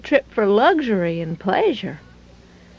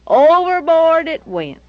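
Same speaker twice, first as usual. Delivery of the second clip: ordinary delivery, nothing marked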